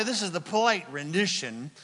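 ordinary talk

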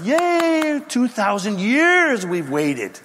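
high, drawn-out mock cheer; cheering crowd. Crowd applauding at the temple ribbon cutting, cheering. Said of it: "everybody's clapping and saying".

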